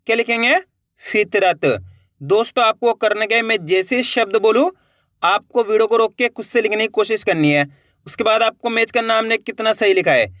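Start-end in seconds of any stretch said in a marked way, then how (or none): none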